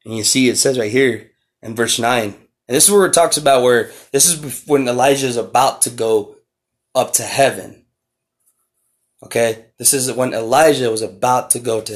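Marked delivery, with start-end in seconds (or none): none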